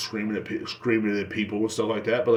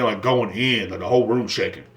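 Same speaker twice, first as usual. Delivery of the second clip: normal talking voice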